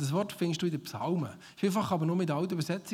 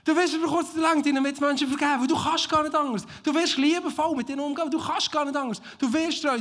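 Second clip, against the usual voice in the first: loud, high, driving preacher voice; fiery preaching; 9 semitones higher, 6 dB louder than usual. Real, not acted. Preacher firing up the congregation, loud and high. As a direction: preach it out at full force to the church.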